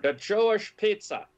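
put-on lightly Austrian-accented, pitched high; a hint of Wolfgang Puck's Austrian accent. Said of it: "I'm doing his impeccable Austrian accent".

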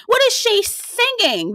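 high, slow disbelief. Retold or imitated. Recapping the show to viewers, high and slow in disbelief. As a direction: repeat it high and slow, like you cannot believe it.

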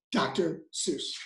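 ordinary delivery

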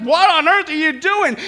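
high, loud comic alarm; gasped shock. Acted. Bride woken by her searching husband, gasping alarm. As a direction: exclaim it like a startled bride. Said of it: "she gasped".

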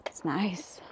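breathy hushed whisper. Real, not acted. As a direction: whisper it so the cave stays quiet. Hushed remark exploring cave.

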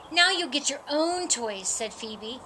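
plain reading voice

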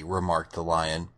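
ordinary delivery, nothing marked

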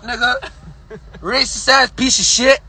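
usual speaking voice

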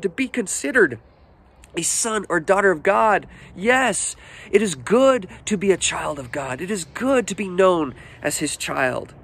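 high, drawn-out impassioned appeal; fervent preaching. Real, not acted. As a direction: preach it with soaring pitch and stretched key words. Preaches with rising fervour.

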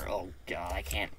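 ordinary talk

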